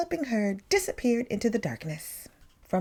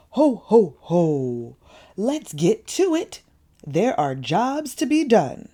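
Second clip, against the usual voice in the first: big loud jolly Santa voice; loud, jolly Father Christmas; booming Santa; 6 dB louder than usual. A narrator's reading. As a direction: boom it out like a hearty Santa. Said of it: "boomed Santa".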